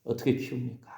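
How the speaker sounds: mother's lament, low and very soft; imitated tearful prayer. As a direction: plead quietly to God as a weary mother, without real sobbing. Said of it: "엄마는 울면서". (엄마의 울음 섞인 기도를 나직이 흉내)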